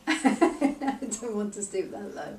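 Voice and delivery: laughing. Real, not acted. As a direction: chuckle while saying it slowly. Client laughs repeating phrase.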